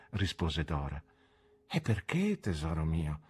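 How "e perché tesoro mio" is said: softly asking, tender and quiet; gently. A narrator's reading. Husband coaxing his young wife, softly and tenderly. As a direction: ask her gently, falling soft. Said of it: "le chiesi con dolcezza".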